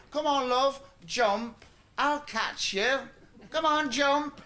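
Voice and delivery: high, loud, coaxing father voice; coaxing dad. Retold or imitated. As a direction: call up to the child on the table, all encouragement. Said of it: "he says".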